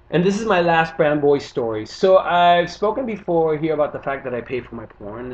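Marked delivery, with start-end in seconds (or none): none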